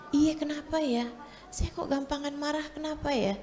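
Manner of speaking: imitated wife, softly wondering; puzzled self-questioning. Wife answering her husband about her temper, quietly puzzled. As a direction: ask it softly, as if musing to yourself.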